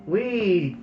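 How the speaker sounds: a Highland burr, half lamenting; Highland accent. Acted. Doogie rueing their folly to Tam, Highland accent.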